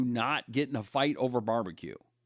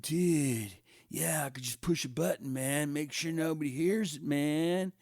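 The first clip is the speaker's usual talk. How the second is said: mock stoner Zuckerberg; 4 dB quieter than usual. Zuckerberg voiced in stoner skit.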